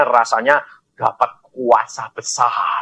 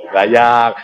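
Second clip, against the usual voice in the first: ordinary talk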